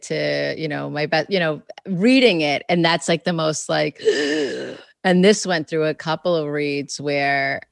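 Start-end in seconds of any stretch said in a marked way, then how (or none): 4.1-4.7 high, sharp gasp; nervous gasp. Acted. Sharing your draft with family, a high anxious gasp. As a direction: gasp as if bracing for a verdict.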